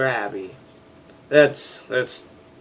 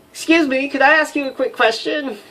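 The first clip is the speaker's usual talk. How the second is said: much higher put-on woman's voice; female voice; 6 dB louder than usual. Flight officer greeting the major, high girly voice. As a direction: squeak it out as a chirpy young woman. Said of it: "showcase of me failing at female voices".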